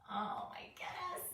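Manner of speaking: whispered oh my goodness; whispered aside. Real, not acted. Teacher reacts to the picture, amused whisper. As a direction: breathe it in a hushed whisper.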